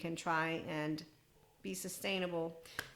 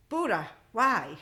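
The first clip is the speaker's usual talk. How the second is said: loud, slow, pleading call to Buddha; 3 semitones higher, 8 dB louder, slower than usual. Pretend. Exasperated plea to Buddha, loud and slow. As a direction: cry it out to the sky.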